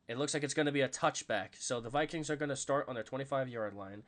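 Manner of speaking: usual speaking voice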